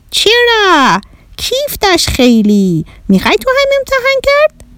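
put-on very high, gleeful alien child; little Martian boy. Bahram inviting Shadi to try it, little Martian voice. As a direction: squeak it high, eager to share. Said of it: "بهرام کوچولو گفت".

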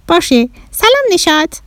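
high-pitched little Martian; little Martian boy. Acted. Bahram obediently greets Neshat, little Martian voice. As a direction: pipe up high as an alien child. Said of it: "بهرام گفت".

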